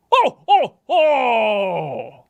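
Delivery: high, loud mean ho ho ho; angry Santa laugh. Shown as a demonstration. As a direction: laugh like a mocking bully. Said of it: "Is that angry? Too angry?".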